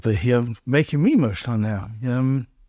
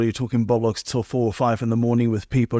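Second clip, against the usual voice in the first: ordinary talk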